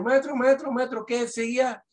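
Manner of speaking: high-pitched mock student calling the teacher; alumno llamando. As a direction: play a pupil calling out to the teacher. Imita alumno que llama. (imitación aguda de un alumno que llama al maestro)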